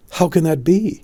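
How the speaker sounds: put-on a troubled boy telling his dream; lightly voiced anxious boy; young Arthur. Arthur asks Merlin.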